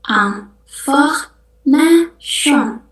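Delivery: "Information" very slow, aŋfahnaʃon; French pronunciation. Shown as a demonstration. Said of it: "kita bacanya information". (ucapkan "Information" sangat pelan)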